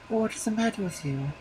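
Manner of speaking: high wife voice; a high, puzzled wife. A narrator's reading. Wife asking her husband in a high voice.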